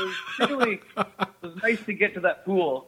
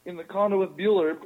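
usual speaking voice

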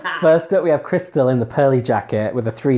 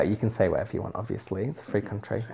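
ordinary talk

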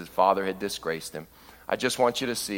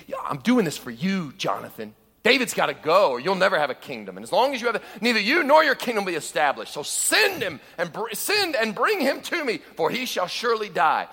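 higher and louder, building to a cry; voicing Saul; 9 semitones higher, 4 dB louder than usual. Saul pressing Jonathan to hand over David, voiced higher and louder. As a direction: plead then demand, as a scheming king. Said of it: "So you think".

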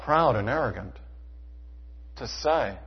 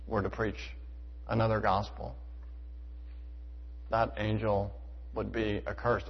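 hushed solemn warning; 6 semitones lower, 11 dB quieter than usual. Real, not acted. Preacher lowers voice on angel warning.

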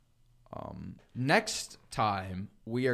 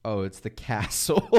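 normal talking voice

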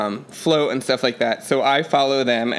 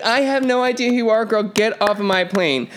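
higher-pitched sassy comeback; mock dismissive retort; 8 semitones higher than usual. Flight attendant ordering passenger off his plane, mock indignant. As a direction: send her off with mock indignation.

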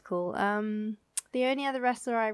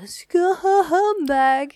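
drawn-out, loud "scumbag"; emphatic insult; 10 semitones higher, 8 dB louder than usual. Real, not acted. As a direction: stretch the first syllable of "scumbag" in bouncy pulses. Vetoes the wrestler with relish.

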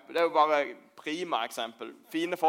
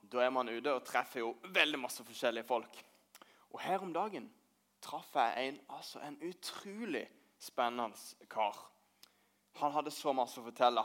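hushed storytelling; 3 semitones lower, 7 dB quieter than usual. Acted. Storyteller lowers voice mysteriously.

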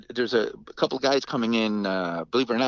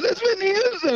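much higher, louder shriek of amazement; amazed squeal; 5 dB louder than usual. Retold or imitated. Caterer shrieks at seeing Whitney Houston, high loud squeal. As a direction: squeal it like a starstruck fan.

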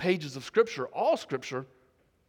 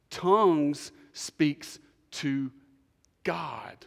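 very slow, with a pause before GOD; slow and deliberate; much slower than usual. Real, not acted. Preacher contrasts tongues with prophecy, slow and deliberate. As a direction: stretch TONGUES and hold back GOD until after a pause.

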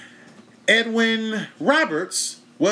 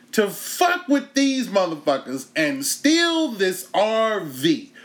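normal talking voice

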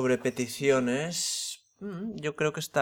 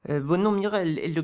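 put-on French accent; 5 semitones higher than usual. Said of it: "con este acento".

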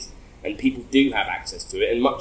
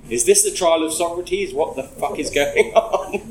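higher, disbelieving cry breaking into a laugh; incredulous outburst; 8 semitones higher than usual. Retold or imitated. Walking into the school meeting, stunned and incredulous. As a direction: play it like you just walked into a kangaroo court.

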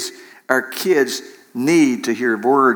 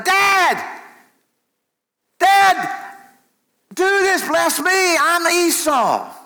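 very high-pitched shouted plea; wailing desperate cry; much slower than usual. Acted. Esau calls to his father for blessing, desperate wail. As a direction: cry out for Dad in acted desperation. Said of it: "lifted up his voice and wept".

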